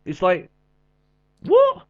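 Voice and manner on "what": incredulous. Real, not acted. Baffled at the match ending.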